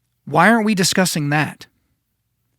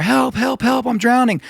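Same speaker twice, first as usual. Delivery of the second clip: put-on higher, panicked child's call for help; mock cries for help; 8 semitones higher than usual. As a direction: act out a drowning kid crying for help. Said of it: "the screams and the cries of a child".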